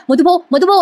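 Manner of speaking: a light imitation of her panicked call; mock shout. Heroine calling out to the unconscious man, a mild mock shout. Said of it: "मोठ्या मोठ्याने ती ओरडत असते".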